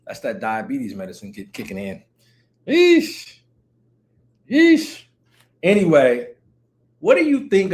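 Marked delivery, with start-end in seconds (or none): 2.7-5.0 high yeesh squeal. Real, not acted. Reacting to viewer.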